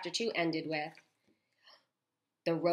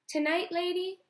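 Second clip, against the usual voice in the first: high, louder grandmother voice; Pellegrina's voice; 13 semitones higher, 5 dB louder than usual. A narrator's reading. Grandmother promising a story at bedtime, high voiced. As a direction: announce it with grave authority.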